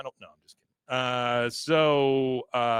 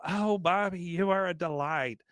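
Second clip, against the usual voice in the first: put-on high, doting old-lady voice; imitated fond tea-room lady; 6 semitones higher than usual. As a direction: gush over a charming young guest. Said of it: "the old ladies are talking to Bobby".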